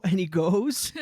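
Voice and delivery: normal talking voice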